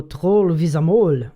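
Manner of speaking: exaggerated imitation of a French accent; mock French accent. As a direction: put on a jokey French accent. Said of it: "It's like a French person, when they go".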